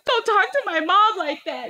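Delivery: put-on high-pitched scolding kid voice; Wilder. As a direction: imitate a child defending mom. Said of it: "She goes".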